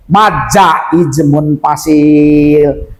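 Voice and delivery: slow, low, drawn-out MAD JAIJ MUNFASIL; slow term naming. Shown as a demonstration. Teacher introduces a new rule name, slowly intoned. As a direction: spell the term out slowly, stretching the last syllable. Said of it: "saya menyampaikan dulu".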